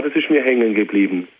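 ordinary talk, nothing marked

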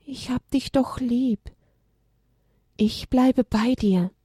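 slow, tender reassurance to a sad friend; comforting. Acted. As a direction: console a grieving friend gently. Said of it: "Trost zu spenden".

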